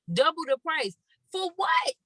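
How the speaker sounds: high, outraged question; indignant. Real, not acted. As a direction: scoff at the price. Outraged at doubled hotel price.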